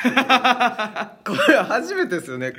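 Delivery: high, slow, laughing through the words. Real, not acted. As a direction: laugh while you say it. Laughing at the coincidence.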